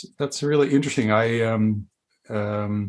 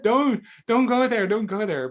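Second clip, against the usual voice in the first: mock horror; 10 semitones higher than usual. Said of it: "You know, I was like".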